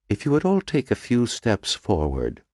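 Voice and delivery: gentle Irish lilt, Dublin vowels, unhurried; a soft-spoken Dublin doctor inviting everyone closer; soft Dublin accent. A narrator's reading. Medical examiner to the gathered group, soft Dublin accent. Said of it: "he said in a soft Dublin accent".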